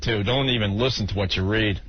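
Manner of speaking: ordinary delivery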